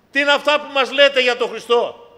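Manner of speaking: δυνατή, ψηλή ερώτηση, τονίζει το «λέτε»; το πετάει δυνατά στο εκκλησίασμα; κηρυγμένη ερώτηση. Acted. Rhetorical question at full force. (φωνάζει την ερώτηση με πάθος)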